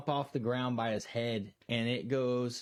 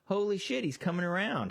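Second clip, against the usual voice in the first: surprised; 6 semitones higher than usual. Retold or imitated. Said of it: "you're like".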